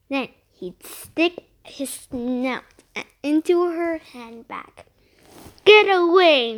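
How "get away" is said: a sharp snap, louder and higher; snapped. A narrator's reading. Missus Finnegan shooing the pig away, snapped. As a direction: snap it loudly, storybook crossness. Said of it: "Missus Finnegan always snapped".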